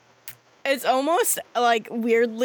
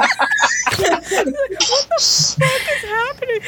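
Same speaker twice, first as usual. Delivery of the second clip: baffled laughing cry; 11 semitones higher, 4 dB louder, slower than usual. Acted. Niall appears, baffled.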